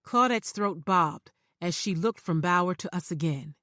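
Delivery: usual speaking voice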